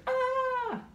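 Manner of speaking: put-on very high demented baby shriek; imitated baby scream. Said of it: "It's like screaming".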